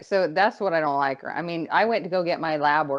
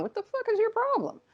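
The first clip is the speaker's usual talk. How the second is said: incredulous quote; 5 dB quieter than usual. Retold or imitated. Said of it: "We're all looking at her going".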